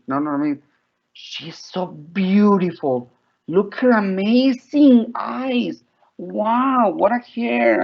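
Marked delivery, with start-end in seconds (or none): 1.1-7.8 high, slow, drawn-out marvelling; gushing admiration. Shown as a demonstration. Teacher demonstrating descriptive words to use with children, high gushing admiration. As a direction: gush over her, stretching the adjectives. Models gushing with rich vocabulary.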